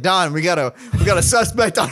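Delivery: half-laughing send-up of a police call; mock police dispatcher. Dispatcher sending Don to a suspect, mock urgent police voice.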